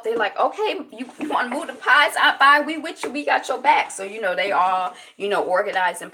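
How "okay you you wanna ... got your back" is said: high, eager helping voice; eager imitation of relatives trying to help; supportive family. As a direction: rally round her in a raised pitch. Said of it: "like, trying to help".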